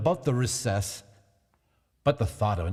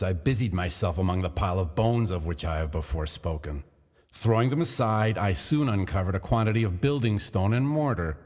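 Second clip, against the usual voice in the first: plain reading voice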